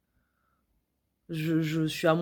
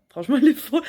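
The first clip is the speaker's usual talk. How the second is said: higher and louder, laughing; laughing disbelief; 7 semitones higher, 8 dB louder, much faster than usual. Retold or imitated.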